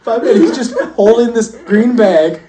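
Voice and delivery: barely getting the words out for laughing; storyteller loses it describing his friend sneaking up stairs; laughing hard. Real, not acted. Cracking up telling the story.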